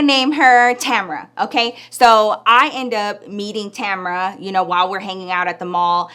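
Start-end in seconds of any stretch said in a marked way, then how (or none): none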